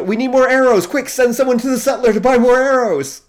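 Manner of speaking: high, loud urgent shout; soldier shouting orders. Retold or imitated. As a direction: yell for arrows in battle. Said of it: "the soldier".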